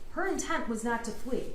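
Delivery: usual speaking voice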